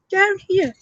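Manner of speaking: high posh mimicry of "Down here". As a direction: put on a posh English voice. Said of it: "did you hear that posh voice then?".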